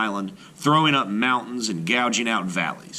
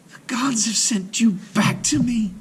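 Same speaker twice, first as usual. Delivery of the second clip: high, whispered joyful cry; breathless joy at her son's return; 13 semitones higher, 3 dB louder than usual. A narrator's reading. Mother greets her returned son with hushed joy. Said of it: "she cried".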